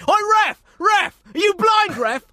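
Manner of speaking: high, loud furious shout; yelling at the ref. Retold or imitated. Angry parent shouting at the referee, full yell. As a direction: scream at the referee from the touchline. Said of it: "You're probably gonna hear them say".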